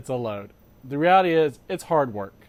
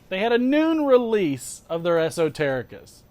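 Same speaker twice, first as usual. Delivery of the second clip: ordinary talk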